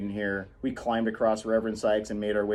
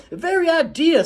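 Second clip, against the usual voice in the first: much higher, louder outraged cry; outraged Calpurnia; 8 dB louder than usual. Acted. Calpurnia scolding Jem, peaking in outrage. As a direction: let it peak, outraged.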